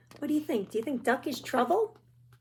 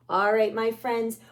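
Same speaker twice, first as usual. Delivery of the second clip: ordinary delivery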